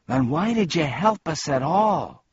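high, indignant demand; angry shout. A narrator's reading. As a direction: raise your voice in angry protest. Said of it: "shouted Milo angrily".

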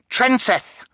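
put-on very high baby shriek. A narrator's reading. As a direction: shriek it out, upset. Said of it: "she shrieked".

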